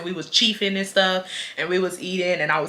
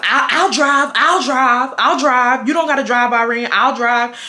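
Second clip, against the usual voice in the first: eager friend; 3 semitones higher than usual. Retold or imitated. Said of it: "his friend talking about".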